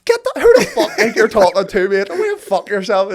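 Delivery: loud, high-pitched mock outrage; angry retort. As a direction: fire back loudly, imitating an indignant mate. Acted angry comeback in retold row.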